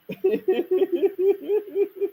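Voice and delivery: long, high giggling cackle; rapid high-pitched laughter; taunting laugh. Real, not acted. Laughs at his own taunt.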